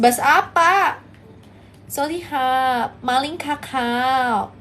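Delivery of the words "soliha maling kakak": very slow mock Thai syllables. Shown as a demonstration. Streamer does very slow mock Thai for the chat. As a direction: stretch each syllable like Thai. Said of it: "Coba bas".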